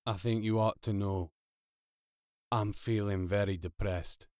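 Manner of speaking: depressed, subdued drone; understated misery, kept low; low, hopeless. A narrator's reading. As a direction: say it as a gloomy robot with no hope. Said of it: "Its voice was low and hopeless".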